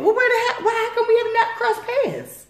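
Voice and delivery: very high, incredulous; exasperated disbelief. Retold or imitated. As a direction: squeal it in disbelief.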